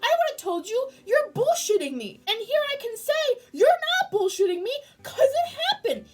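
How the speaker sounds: high, loud, near tears; overwhelmed fan near tears; emotional excited yelling. Real, not acted. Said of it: "Sorry for my incessant screaming".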